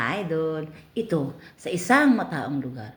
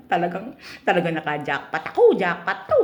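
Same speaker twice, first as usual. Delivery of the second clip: gloating customer; 3 semitones higher than usual. Acted.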